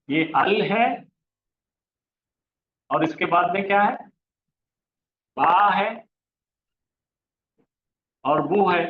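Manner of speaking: ordinary delivery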